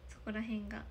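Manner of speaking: usual speaking voice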